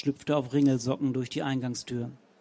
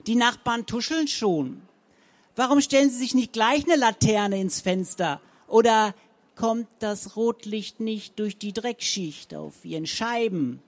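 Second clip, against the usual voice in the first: higher, louder mocking tirade; berating; 6 semitones higher, 4 dB louder than usual. A narrator's reading. Frau Zenker mocks Rabenhorst's windows, loud and higher. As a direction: hammer her with scorn.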